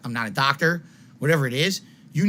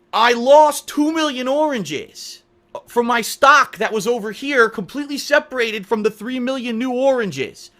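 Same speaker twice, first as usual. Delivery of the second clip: high, loud, slow frantic rant; wild unhinged ranting; 8 semitones higher, 5 dB louder than usual. Real, not acted. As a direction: rant wildly about the oranges. Ranting oranges analogy, high loud.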